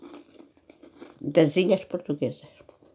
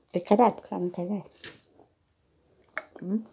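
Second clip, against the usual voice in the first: ordinary talk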